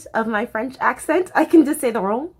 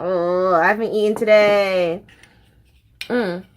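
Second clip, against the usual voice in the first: ordinary talk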